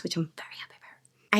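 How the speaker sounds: breathy aside whisper. Real, not acted. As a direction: whisper it like a secret. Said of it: "whispered aside".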